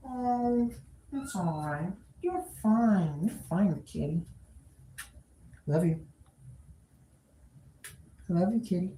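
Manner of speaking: soft, hushed reassuring coo; calm the cat gently; soothing the cat. Real, not acted.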